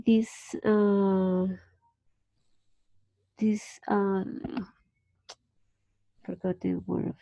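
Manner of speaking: ordinary talk, nothing marked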